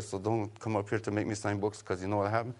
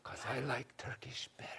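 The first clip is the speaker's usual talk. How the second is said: unvoiced confessing whisper; 3 semitones higher, 13 dB quieter than usual. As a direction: confess it under your breath. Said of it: "He said".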